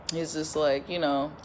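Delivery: normal talking voice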